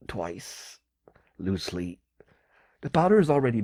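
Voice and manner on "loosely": ordinary talk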